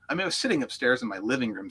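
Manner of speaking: ordinary talk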